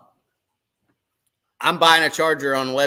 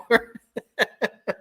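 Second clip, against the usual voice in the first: laugh; 7 semitones higher than usual. Real, not acted. Laughing at end of story.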